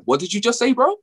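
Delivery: high-pitched, incredulous reaction; hammed-up confused protest, pitched high; mock bafflement. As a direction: act totally lost at what was said. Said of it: "You're like".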